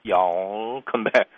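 very drawn-out Southern y'all in "You all come back"; slow, stretched Southern US farewell; Southern US drawl. Shown as a demonstration. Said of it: "그러니까 길게".

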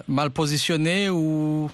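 usual speaking voice

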